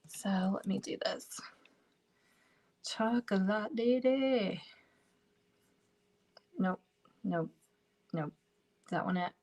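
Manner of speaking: breathy whisper, all air and no voice; whispered aside. Real, not acted. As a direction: whisper it to yourself while searching. Mutters to herself while searching.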